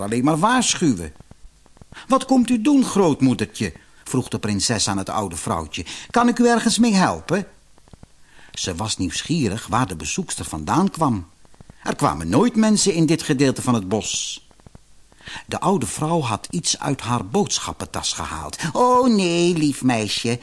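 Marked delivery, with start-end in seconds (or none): 2.1-3.7 high, sweetly inquiring; high-voiced courteous young princess; polite princess. A narrator's reading. Princess politely greets old woman. 6.1-7.5 high, quick polite offer; high-voiced young princess, courteous; helpful princess. A narrator's reading. Princess offers help to visitor. 18.7-20.4 high, falsely sweet crone; wheedling grandmother voice; disguised old woman. A narrator's reading. Disguised villainess coaxes princess.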